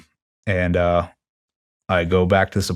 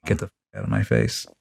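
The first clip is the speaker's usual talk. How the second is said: lower, softer and fast; a quiet retold threat, lower and fast; cold brush-off; 9 semitones lower, 5 dB quieter, faster than usual. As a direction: shut him down low and quick. Confronting the chief, brushing him off.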